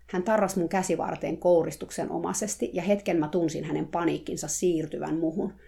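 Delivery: plain reading voice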